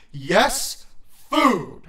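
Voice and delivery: forceful low-voiced yell, falling hard. A narrator's reading. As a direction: bark it back impatiently. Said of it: "he yelled".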